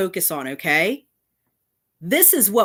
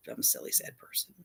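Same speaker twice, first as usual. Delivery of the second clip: sarcastic self-pitying whisper; mock sad whisper; 14 dB quieter than usual. Mocking the critic's insult in a fake sad whisper. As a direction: breathe it out as a mock confession. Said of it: "Yeah, that's right. I'm sad".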